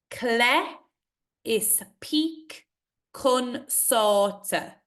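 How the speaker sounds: is peak lifted high, slow line; slow recitation. Acted. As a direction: recite slowly, peak rising. Poem recitation.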